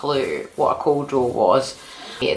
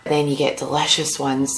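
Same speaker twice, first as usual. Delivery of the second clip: ordinary talk, nothing marked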